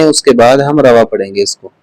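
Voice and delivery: usual speaking voice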